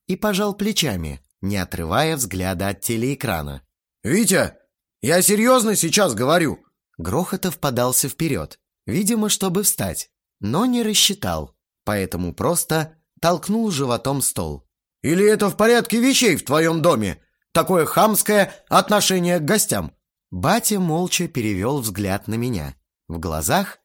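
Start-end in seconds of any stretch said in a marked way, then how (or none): 4.0-6.6 raised, high-pitched demand; exasperated insistence. A narrator's reading. Guest presses father glued to the TV, exasperated. 15.0-20.0 loud, high-pitched challenge; offended indignation. A narrator's reading. Guest challenges host about rudeness, indignant.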